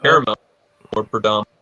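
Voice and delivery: audio dropping out; words cutting in and out on a bad line; choppy cut off endings. Real, not acted. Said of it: "we're losing your audio".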